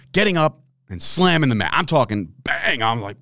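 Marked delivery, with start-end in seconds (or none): none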